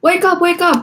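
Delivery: put-on high excited little-girl shout; Brianna screaming. A narrator's reading. Little sister shouting to wake Nikki, high and loud. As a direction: scream it like a kid waking her sister. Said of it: "Brianna screamed".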